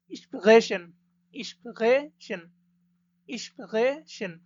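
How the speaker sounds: ইসপ্রেশন drawn out slowly, syllable by syllable; ইসপ্রেশন modelled very slowly; slow model word. Shown as a demonstration. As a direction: spell out ইসপ্রেশন at a slow teaching pace. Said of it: "teacher models the word".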